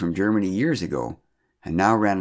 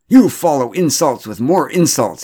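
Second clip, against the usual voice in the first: much higher, much louder, slow; furious declaration; 6 semitones higher, 9 dB louder than usual. A narrator's reading. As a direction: hurl the accusation angrily. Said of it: "he declared".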